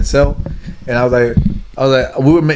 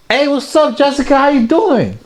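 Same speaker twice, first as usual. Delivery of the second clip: much higher, bubbly charm; overly friendly greeting; 11 semitones higher than usual. Retold or imitated. Greeting a friend's wife at his house, high bubbly charm. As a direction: lay on the charm, pitched way up.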